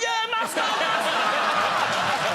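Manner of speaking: put-on very high, loud, drawn-out child's cheer; excited toddler. Daughter confirms the film to dad, excited squeal. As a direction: imitate a small child squealing in triumph.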